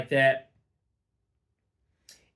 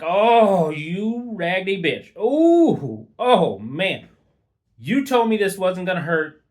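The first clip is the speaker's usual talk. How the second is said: loud, high-pitched acted cry of pain; pained outcry; 6 semitones higher, 7 dB louder than usual. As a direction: howl in mock agony and accusation. Retold outcry at sudden pain.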